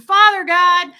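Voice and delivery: booming, high-pitched imitation of praying; loud prayer. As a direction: call out loudly in prayer. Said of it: "he'd be talking all loud and shaking his head".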